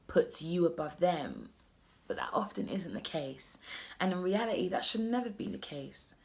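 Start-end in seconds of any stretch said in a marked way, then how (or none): 2.1-3.6 whisper. Real, not acted. Confiding aside.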